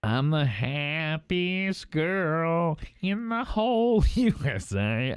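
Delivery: slow, drawn-out Wendell imitation. Hosts goofing around, doing the line slowly as Wendell. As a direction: stretch each word out like Wendell. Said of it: "Do that one as Wendell".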